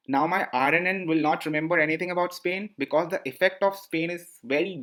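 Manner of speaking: ordinary delivery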